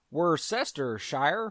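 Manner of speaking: Worcestershire said slowly as wur-SES-ter-shy-er; mock American Worcestershire. Shown as a demonstration. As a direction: spell out Worcestershire syllable by syllable, American style. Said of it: "if you're from America".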